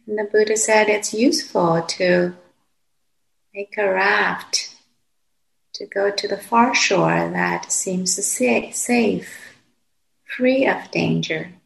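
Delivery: calm, unhurried meditation voice with long pauses; guided meditation. Real, not acted. Teacher guiding meditators to settle into the body, calm and unhurried. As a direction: guide them gently, phrase by phrase, leaving long silences.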